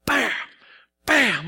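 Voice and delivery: loud, sudden "bam" thuds; imitated pounding heartbeat. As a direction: punch out each bam like a heart thumping. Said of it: "could hear my heart beating".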